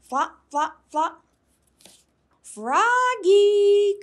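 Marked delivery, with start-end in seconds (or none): 2.4-4.0 high, loud call across the yard; loud singsong call for Froggy. A narrator's reading. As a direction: call him from the doorway. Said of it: "called his mother".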